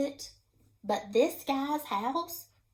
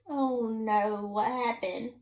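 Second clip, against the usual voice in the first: ordinary delivery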